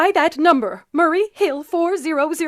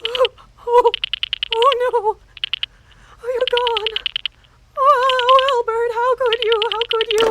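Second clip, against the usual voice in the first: anguished lament; 4 semitones higher, 4 dB louder than usual. Acted. Despairing over Albert.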